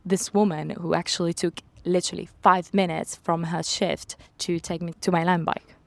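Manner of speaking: ordinary talk, nothing marked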